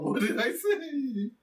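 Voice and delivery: high, slow, bewildered question; mock protest. [name] retelling his reply to Brian, mock protest. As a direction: act out clueless innocence, drawn out and high.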